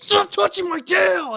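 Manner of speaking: high, loud, drawn-out yell; imitated angry scream. Panda cosplayer scolding a kid at a con, imitated scream. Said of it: "fucking screamed at the kid".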